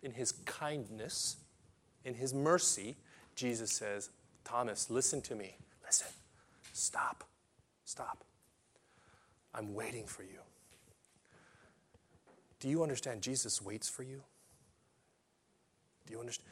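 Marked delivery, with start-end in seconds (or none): 4.4-6.1 hushed and tender, pleading quietly; Jesus gently calling Thomas back, softly; soft gentle Jesus voice. Acted. 6.7-8.2 breathy whispered plea. Acted. Jesus whispering to doubting Thomas. 9.5-16.5 hushed and tender, pleading quietly; Jesus gently calling Thomas back, softly; soft gentle Jesus voice. Acted.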